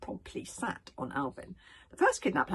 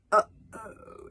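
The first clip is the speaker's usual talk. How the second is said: uh-oh; 4 semitones higher, 8 dB louder than usual. A narrator's reading. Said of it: "said Hiccup".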